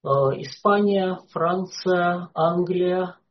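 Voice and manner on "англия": usual speaking voice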